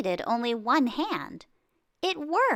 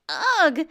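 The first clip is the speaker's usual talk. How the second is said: louder, higher pained groan; 6 semitones higher, 6 dB louder than usual. Acted. Narrator reacting to Josephine's grated knuckle, pained groan. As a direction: groan as if she'd just scraped her knuckle.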